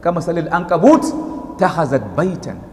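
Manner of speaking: quick, chanted Arabic recitation of the verse; Quran recitation. Real, not acted. To the congregation, recite the Arabic verse in a chant.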